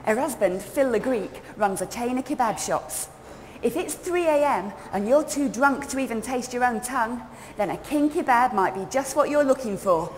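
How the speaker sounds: put-on high, loud, gossipy London girl; bubbly cockney girl spilling gossip; chatty young Londoner. Character narration continues.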